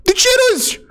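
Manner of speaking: very high, indignant screech; worked-up yell at someone mocking you; outraged shouting. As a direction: demand angrily to know why they laugh. Furious at being laughed at over theft.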